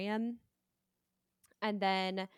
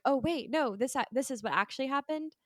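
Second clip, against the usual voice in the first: acted double take, higher and louder; startled realization; 5 semitones higher, 4 dB louder than usual. Viewer realizing the scene was real, acted surprise. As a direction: play a sudden, mildly alarmed realization.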